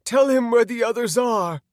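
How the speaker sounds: loud, high, desperate plea; high wailed plea; crying out. Acted. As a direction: beg loudly as a frightened woman. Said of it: "Anna cried".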